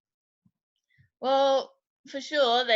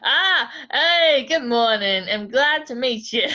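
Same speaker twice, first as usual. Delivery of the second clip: a lightly voiced cheerful talking sword, a bit louder; 2 semitones higher, 5 dB louder, slower than usual. Talking sword greets the party on waking, cheerful and loud. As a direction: greet them brightly as the living blade. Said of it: "the sword is going to start talking".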